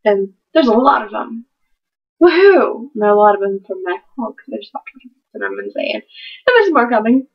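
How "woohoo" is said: louder, higher woohoo; mock cheer. Real, not acted. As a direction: cheer at the pile of books.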